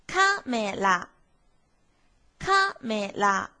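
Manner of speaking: 「カメラ」第一拍高，后面降低，慢读; 把カメラ读成头高型，第一拍最高. Shown as a demonstration. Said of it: "再来看一调". (「カメラ」一调，慢慢读出来)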